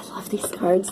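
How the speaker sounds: gasp, then breathe it in a whisper; hushed adoration of the deck; awed whisper. Real, not acted. Reader marveling at her beautiful cards, soft awed whisper. Gushing over tarot cards.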